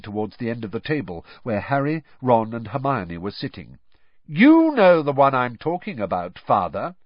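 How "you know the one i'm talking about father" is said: pointed, much louder hint at father; heavy-handed hint, louder. Acted. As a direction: lean hard on you know. Percy hinting to father.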